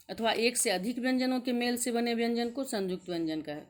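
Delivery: usual speaking voice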